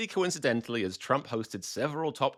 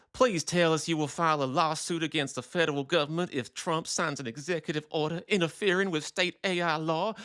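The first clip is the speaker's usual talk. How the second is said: higher-pitched put-on Georgia accent; 4 semitones higher than usual. Mary Beth in Florida writing in, mock Georgia accent. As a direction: read it as a Georgia woman pleading. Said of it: "she's got a Georgia accent".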